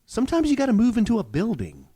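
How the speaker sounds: high, teasing hint at big news; excited teaser. Acted. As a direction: dangle the secret playfully.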